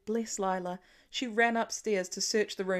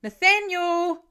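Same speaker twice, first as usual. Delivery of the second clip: loud, high, drawn-out desperate yell; 9 semitones higher, 10 dB louder, slower than usual. Acted. Father yelling his son's full name into the night. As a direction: bellow the full name with hands cupped. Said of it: "after he yelled it".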